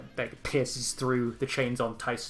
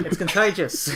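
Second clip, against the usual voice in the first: higher, louder Aussie twang; Australian accent; 6 semitones higher, 6 dB louder than usual. Acted. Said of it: "the Australian accent".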